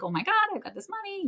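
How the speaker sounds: high and fast, gushing; giddy disbelief. Retold or imitated. New money winner gasping at the sudden cash. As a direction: blurt it out like you just got rich. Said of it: "you're like".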